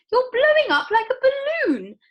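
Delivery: very high, loud screech. Acted. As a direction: screech in panic. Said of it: "screeched Missus Beauregard".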